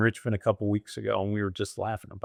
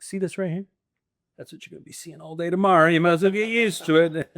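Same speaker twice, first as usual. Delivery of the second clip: high, fast cocky taunt, hamming it up; a friend's teasing boast the night before the race; mock taunt; 7 semitones higher, 3 dB louder than usual. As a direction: rub it in his face, playful and higher.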